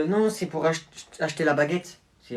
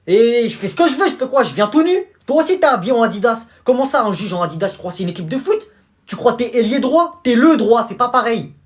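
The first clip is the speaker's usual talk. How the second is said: loud, higher, angry back-and-forth; heated quarrel; 5 semitones higher, 9 dB louder than usual. Acted. Dad and judge son yelling at each other in court. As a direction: shout him down in a row.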